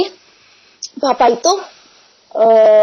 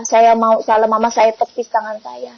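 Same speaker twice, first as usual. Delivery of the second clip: normal talking voice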